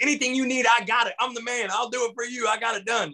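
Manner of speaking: high, overeager yes-man rush; hammed-up starstruck fan pitch; pumped-up eager fan. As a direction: oversell yourself to your hero. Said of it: "so pumped".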